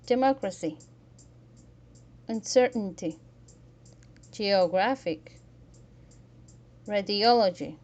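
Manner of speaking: "democracy", "uncertainty", "geographic", "radiology", each loud with one punched syllable; "democracy", "uncertainty", "geographic", "radiology", spaced out as emphatic stress demos; third-from-last syllable stress. Shown as a demonstration. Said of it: "Stress the third form last syllable".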